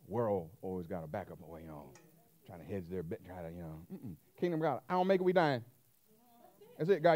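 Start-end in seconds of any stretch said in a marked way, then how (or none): none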